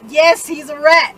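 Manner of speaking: loud, drawn-out confirmation; emphatic retort. Retold or imitated. Storyteller answering whether he's a rat, loud and emphatic. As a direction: land it loudly and slowly.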